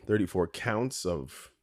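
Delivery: normal talking voice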